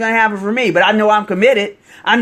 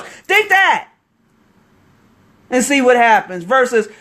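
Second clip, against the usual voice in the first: higher-pitched, impassioned urging; really excited; 5 semitones higher than usual. Real, not acted. Coach urging viewers to think they'll succeed, really excited. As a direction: get carried away, driving each repeated phrase home higher. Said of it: "I'm getting really excited here".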